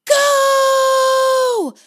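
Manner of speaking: long, high yelled command; piercing drawn-out battle cry; full-out shouted exclamation. A narrator's reading. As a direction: scream the signal across a forest. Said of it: "Her exclamation echoed through the forest".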